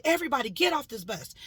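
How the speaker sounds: fast, loud command to everyone; shouting. Retold or imitated. Ordering every passenger off the bus, shouting. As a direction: bark it out to the whole bus.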